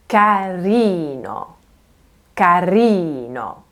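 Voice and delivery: CARINO slowly, leaning long on RI; leaning on second-to-last syllable. Shown as a demonstration. Teacher illustrating Italian rhythm, lingering stress on RI in carino. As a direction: stretch the ee in ca-RI-no. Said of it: "Can you hear how I'm leaning".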